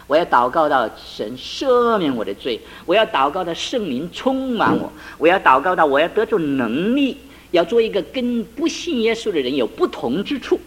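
a wife's resolve swelling into a cry; climbing pleading vow; building fervent vow. Retold or imitated.

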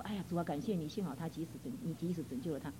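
a hushed private prayer; a quiet grateful prayer to the Lord; soft prayer. Retold or imitated. Quoting her own prayer.

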